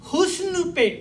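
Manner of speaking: measured mushaira recitation with long pauses; poetry recital. Acted. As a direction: recite the couplet phrase by phrase, letting each hang. Recites his couplet to an audience.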